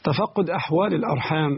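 normal talking voice

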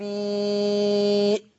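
النبي with a very long held ī before the hamza; hugely elongated النبي ending in a glottal stop; six-count madd. Shown as a demonstration. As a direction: chant النبي, holding the final ī steady, then stop it in the throat. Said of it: "المد المتصل عند ورش كم حركة؟".

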